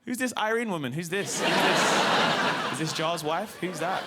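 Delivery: put-on little kid confused about who Irene is; comic retelling of his child self asking dad; four-year-old me.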